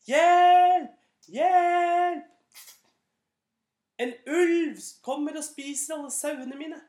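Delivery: high, loud fake cry for help; shouting for help. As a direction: yell it up the mountain to fool the village. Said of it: "ropte så høyt han kunne". (falskt rop om hjelp, høyt og lyst)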